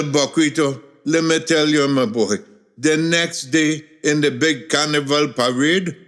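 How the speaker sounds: ordinary delivery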